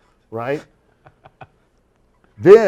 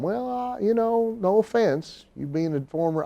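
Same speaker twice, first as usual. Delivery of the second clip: high, halting country promoter; hesitant promoter; 9 semitones higher, 3 dB quieter, slower than usual. Retold or imitated. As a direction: hedge nervously up high. Promoter voiced, much higher.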